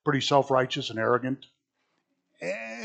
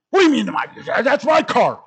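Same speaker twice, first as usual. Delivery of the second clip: indignant outburst; 10 semitones higher, 6 dB louder than usual. Retold or imitated. Angry driver after car accident.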